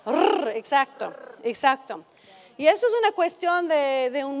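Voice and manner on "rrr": long trilled Spanish rr; rolled R demo. Shown as a demonstration. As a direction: roll the tongue in a drawn-out "Rrr". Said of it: "¿es cuál?".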